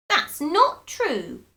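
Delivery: put-on high pleading old woman; high, slow cry. A narrator's reading. Wife cries out high, refusing to believe him. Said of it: "cried Missus Twit".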